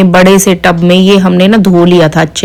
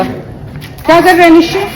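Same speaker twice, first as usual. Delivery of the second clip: much higher, rising amused question; teasing surprise; 11 semitones higher than usual. Real, not acted. Sister teases brother struggling with the log, high amused question. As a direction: ask him what on earth he's doing, amused.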